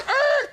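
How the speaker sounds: very high, loud tire screech; screeching brakes imitation. Storyteller imitating the limo slamming to a halt, screeching.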